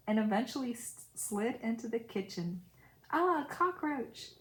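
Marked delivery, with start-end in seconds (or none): 3.1-4.3 higher, slow cry of alarm; a staged shout of surprise at a cockroach; fake alarm. A narrator's reading. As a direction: feign a sudden scare to distract her. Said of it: "The moment I said that".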